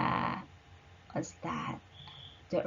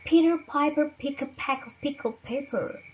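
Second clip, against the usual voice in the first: quick, higher tongue twister; tongue twister demo; 7 semitones higher, faster than usual. Shown as a demonstration. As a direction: rattle it off quickly. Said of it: "For example".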